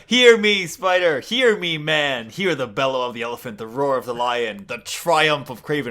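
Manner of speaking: hammed-up triumphant speech; bombastic proclamation. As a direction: declaim it like a crowing villain. Kraven proclaims himself atop elephant.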